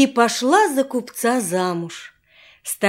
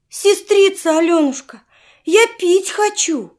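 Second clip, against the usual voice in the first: put-on higher, louder child's plea; pleading little boy; 7 semitones higher, 3 dB louder than usual. A narrator's reading. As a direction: beg like a thirsty little boy. Little brother begs for water.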